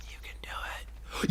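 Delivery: whispered encouragement. Real, not acted. Player whispering encouragement to the beetle. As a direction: urge it on under your breath.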